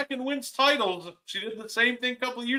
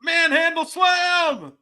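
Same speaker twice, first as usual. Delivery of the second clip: higher, slow, loud announcer shout; announcer call; 8 semitones higher, 4 dB louder, slower than usual. Pretend. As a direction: call the move like a ring announcer. Calling move like announcer.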